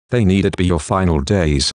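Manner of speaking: ordinary delivery, nothing marked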